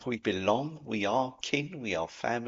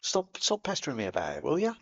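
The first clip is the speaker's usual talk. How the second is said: higher, breezy mock bloke waving away concern; brushing it off; 8 semitones higher, faster than usual. Imitating a heartbroken mate who refuses to talk, higher and breezy. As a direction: imitate a mate insisting he's fine. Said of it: "You split up with".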